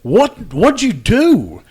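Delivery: loud, high retold cry of shock; shocked outburst. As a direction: blurt it out, stunned and loud. Shocked at the swollen testicle.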